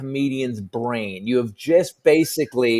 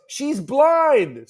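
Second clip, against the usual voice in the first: someone shouting; 10 semitones higher, 4 dB louder than usual. Retold or imitated. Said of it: "someone goes".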